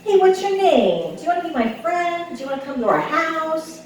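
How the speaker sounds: put-on high, eager little boy voice; son's voice. Outgoing son greeting strangers, high eager kid voice. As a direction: chirp it like a bubbly little kid. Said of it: "being like".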